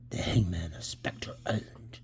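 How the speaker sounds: slow, low, hushed; spooky title read. Acted. Reading the comic's ghostly title, low and spooky. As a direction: drop to an eerie, drawn-out whisper.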